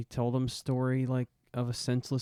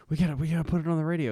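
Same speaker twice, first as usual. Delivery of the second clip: urgent rookie; 6 semitones higher, 4 dB louder, much faster than usual. Retold or imitated.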